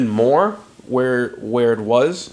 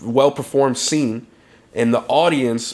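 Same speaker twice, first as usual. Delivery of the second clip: ordinary talk, nothing marked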